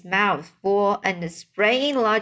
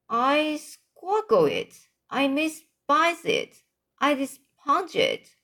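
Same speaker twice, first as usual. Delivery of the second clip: higher voice for the giant's disgust; the BFG crying out in disgust, pitched higher; 6 semitones higher than usual. Acted. Giant crying out about the snotcumber, higher. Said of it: "cried the B F G".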